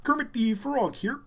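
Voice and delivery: high puppet frog voice; Kermit. Shown as a demonstration. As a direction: trigger phrase in a high frog voice. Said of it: "trigger thing that makes you do the voice".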